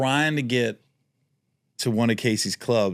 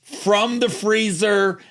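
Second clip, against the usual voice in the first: high, loud, hammed-up demanding drunk; dragging out the last line through a laugh; Ralphie May voice; 7 semitones higher, 4 dB louder, slower than usual. Ralphie ordering the manager to fetch a Michelob, high and mock demanding.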